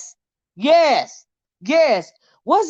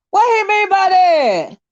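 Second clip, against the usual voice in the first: emphatic repeat; 7 semitones higher than usual. Real, not acted. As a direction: repeat it, stretching out the disbelief. Repeats a viewer comment louder.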